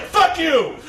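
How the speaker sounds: high, loud mock outburst; hammed-up commentator snapping back; angry retort. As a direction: imitate a furious dismissal. Acted commentator quarrel.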